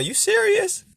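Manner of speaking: squeaky-high doubting hater; high-pitched mock hater. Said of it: "then the hater come".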